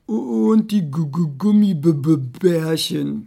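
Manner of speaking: loud, slow, stuttering fish burble; bubbling goldfish. Acted. Goldfish wanting gummy bears, burbling with stutters. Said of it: "blubberte der Goldfisch".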